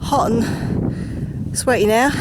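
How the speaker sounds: normal talking voice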